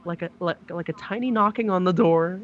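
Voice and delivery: ordinary talk, nothing marked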